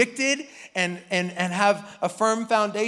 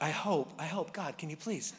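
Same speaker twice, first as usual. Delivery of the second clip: much softer, low nervous aside; under his breath; 5 semitones lower, 11 dB quieter than usual. Acted. Moses praying under his breath, very soft and low. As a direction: mutter a desperate prayer. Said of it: "And then under his breath".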